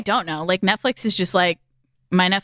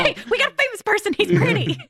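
higher, quick, gleeful exec voice; mock studio exec; 10 semitones higher than usual. Joining the mockery of studio executives, higher quick exec voice. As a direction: rattle off the cash-grab pitch, pitched up.

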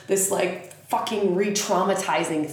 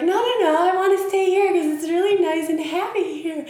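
put-on childish high refusal; 10 semitones higher, faster than usual. Telling Pluto she wants to stay happy, high and whiny. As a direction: dig in like a kid who won't budge. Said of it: "And you're like".

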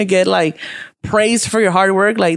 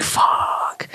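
muttered fuck under the breath; whispered curse; 5 dB quieter than usual. Retold or imitated. Seeing the homeless man ignored, a whispered curse. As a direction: breathe it out voicelessly, annoyed. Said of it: "I'm like".

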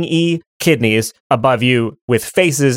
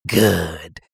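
low, dark one-word reply; cold right kidney; 9 semitones lower, 3 dB quieter than usual. Acted. Right kidney relishing brother's need, low and grim. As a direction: drop low with grim satisfaction. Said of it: "Right Kitty goes".

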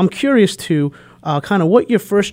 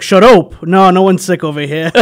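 put-on loud Portuguese-accented grandma saying "up" like "ope"; grandma's Portuguese accent; 4 semitones higher, 7 dB louder than usual. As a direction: imitate grandma's Portuguese-accented English, raised voice. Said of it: "And she's like".

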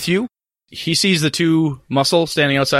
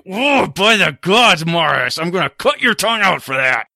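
enraged threat; 6 semitones higher than usual. Acted. Cutter threatening Morris after the blast, enraged. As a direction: roar it through the hurt, murderous. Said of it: "Cutter's face from rage, anger, murder".

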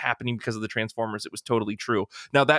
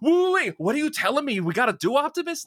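[name] impression; 13 semitones higher than usual. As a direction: play Mark Wahlberg baffled by orders. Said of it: "that was a pretty good [name]".

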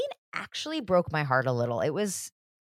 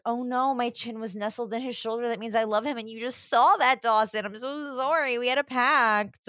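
higher-pitched, hammed-up apology; mock guilty dismay; 5 semitones higher than usual. Co-host voicing Joey apologizing to Dawson, mock guilty dismay. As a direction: play a dismayed girl caught out, pitched up.

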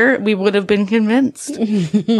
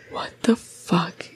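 slow, hushed disbelief; low whispered mock astonishment; stunned whisper; 3 semitones lower, 6 dB quieter, slower than usual. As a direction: whisper it slowly, imitating shock. Retold stunned reaction at window.

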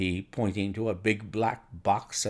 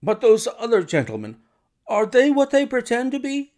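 high, strained, suspicious questioning; jangled nerves; 12 semitones higher, 5 dB louder than usual. A narrator's reading. Nervous patient doubting the strangers, jangled nerves. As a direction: question them anxiously in a high, louder voice. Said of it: "testified to his jangled nerves".

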